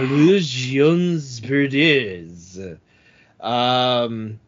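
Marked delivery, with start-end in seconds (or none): 0.0-2.6 slow, hammed-up French accent; mock French accent. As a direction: drag it out in a jokey French accent. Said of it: "your French accent is just making fun of French accents".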